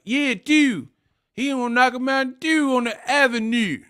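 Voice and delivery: high-pitched mock Baltimore accent. Host quoting Baltimore fight fans in the street, high mock Baltimore accent. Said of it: "that accent is".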